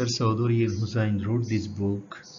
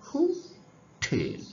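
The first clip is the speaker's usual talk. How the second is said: Hotel split slowly, high হু, then falling, punched টেল; stress on TEL; 14 semitones higher, much slower than usual. Shown as a demonstration. Teacher showing students where stress falls, hotel broken slowly before টেল. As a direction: draw hotel far apart and land hard on টেল. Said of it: "ঠেল জোরে".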